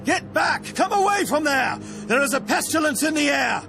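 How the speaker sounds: high-pitched warning cry to the troops; shouted battlefield alarm; urgent warning. Acted. Commander warns troops of pestilence.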